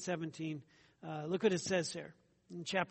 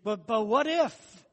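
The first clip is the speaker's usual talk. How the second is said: loud, higher-pitched stammered objection; acted protest from the congregation, raised in pitch and volume; objecting listener; 4 semitones higher, 8 dB louder than usual. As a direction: cut in as a doubter, loud and drawn out. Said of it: "someone in some group is gonna say".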